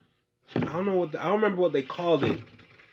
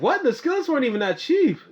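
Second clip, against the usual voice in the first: incredulous; 13 semitones higher, 5 dB louder than usual. Real, not acted. Disbelieving about the price.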